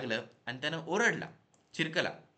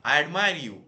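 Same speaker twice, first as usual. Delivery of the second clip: echoed shout; 10 dB louder, much slower than usual. A narrator's reading. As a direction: send the shout back as an echo. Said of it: "पर्वताकडून पुन्हा आवाज आला". (डोंगरातून आलेला मोठा प्रतिध्वनी)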